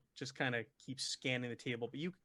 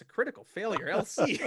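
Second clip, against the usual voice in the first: higher, louder, breaking into laughter; amused; 7 semitones higher, 8 dB louder than usual. Real, not acted. Game master reveals a player's critical failure, laughing out loud. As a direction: announce the failure and crack up.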